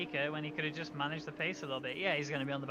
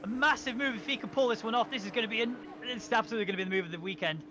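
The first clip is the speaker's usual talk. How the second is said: rising, louder race call; excited commentary; 5 semitones higher, 5 dB louder than usual. Real, not acted. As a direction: build the excitement of the overtake. Excited call of a double dive.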